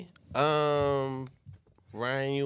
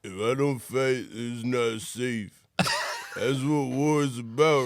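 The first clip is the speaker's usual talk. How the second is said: Rambo voice; 3 dB louder than usual. Acted. Rambo gruff line.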